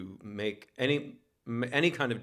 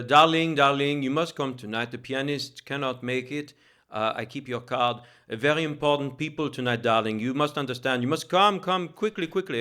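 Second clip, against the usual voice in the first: imitating a theatrical Frenchman, loud and higher; French accent; 4 semitones higher, 6 dB louder than usual. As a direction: gush "darling" in a hammed-up French accent, urging. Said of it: "very thick French accent".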